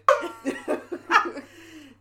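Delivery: high, loud burst of laughter. Real, not acted. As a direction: laugh out hard. Joke about exploding.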